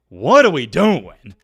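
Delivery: loud, slow exasperation; exasperated outburst. Real, not acted. Ranting to viewers about the saltless pretzel, exasperated outburst. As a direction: demand it loudly, drawing out the words.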